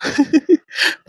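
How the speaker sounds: a short breathy high laugh; teasing little laugh. Real, not acted. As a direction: laugh lightly at her tears. Teasing his crying sister.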